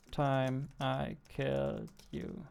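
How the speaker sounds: ordinary talk, nothing marked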